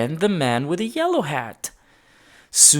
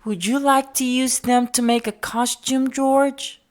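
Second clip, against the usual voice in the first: higher, kindly lady voice; Mrs Gray's voice; 10 semitones higher than usual. A narrator's reading. Mrs Gray offering George dress-up clothes, in a kindly voice. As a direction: voice her as a gentle older lady. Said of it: "said Missus Gray".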